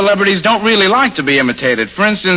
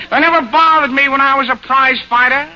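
W C Fields; 9 semitones higher than usual. Retold or imitated. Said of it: "here's what W C Fields has to say".

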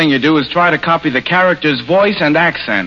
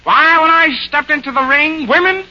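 W C Fields; 9 semitones higher than usual. Retold or imitated. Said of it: "here's what W C Fields has to say".

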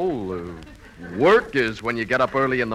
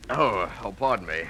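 ordinary talk

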